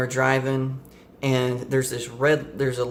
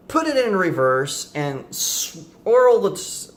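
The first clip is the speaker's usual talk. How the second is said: excited; 6 semitones higher, 5 dB louder, much slower than usual. Real, not acted. Dramatic retelling of dream crash.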